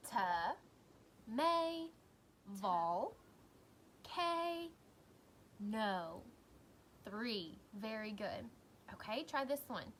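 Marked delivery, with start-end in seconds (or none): 0.1-6.2 "Ta-may-vol-cano" very slowly, one beat at a time; long pauses between syllables of "Ta-may-vol-cano"; chopped into syllables. Shown as a demonstration. Teacher chopping words for pupils, "Ta-may-vol-cano" in separate beats. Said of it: "Chop it out for me".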